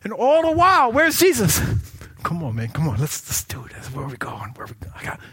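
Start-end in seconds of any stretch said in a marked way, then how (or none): 3.9-5.2 whispered and hushed, backing away; whispering. Retold or imitated. Rich young man whispering as he slips away from Jesus. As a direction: hush it like he's sneaking off.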